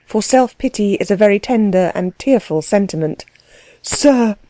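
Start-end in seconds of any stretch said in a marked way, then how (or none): 3.8-4.4 higher, mildly wounded exclamation; near tears. A narrator's reading. Klutz tries once more to answer, near tears. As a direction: protest while holding back tears. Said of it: "struggling hard with a dreadful inclination to cry again".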